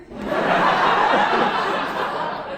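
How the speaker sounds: long loud breathy laughing fit; loud laughter. Real, not acted. Laughs at McDonald's punchline.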